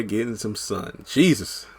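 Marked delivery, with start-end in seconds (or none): none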